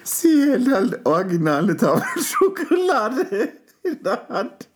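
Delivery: words squeezed out through laughter; laughing while talking. Real, not acted. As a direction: speak through helpless giggles. Laughing while describing her.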